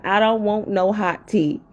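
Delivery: ordinary talk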